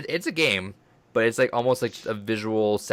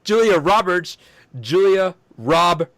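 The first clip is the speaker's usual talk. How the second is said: high, loud, slow mock poet; hammed-up performance poet; slam poetry voice; 8 semitones higher, 6 dB louder, much slower than usual. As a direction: stretch the name out like a slam poet. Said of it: "slam poetry quote".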